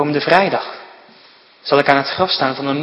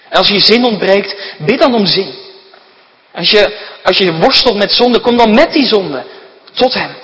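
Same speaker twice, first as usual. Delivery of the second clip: fervent preaching; 3 semitones higher than usual. Acted. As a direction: plead with the congregation at full force. Preacher urges prayer and coming.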